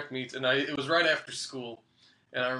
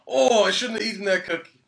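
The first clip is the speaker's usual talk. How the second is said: mock groaning regret; 4 semitones higher, 6 dB louder than usual. Acted regret during cramp.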